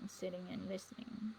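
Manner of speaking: breathy echo of the line, no voice; soft whispered aside. Shown as a demonstration. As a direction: whisper the repeat of sitting and listening. Repeats phrase, second whispered.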